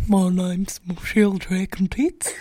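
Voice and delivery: loud, low, slow put-on character voice; Michelle. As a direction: belt out a drawn-out character introduction. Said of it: "Michelle's, like, the most advanced".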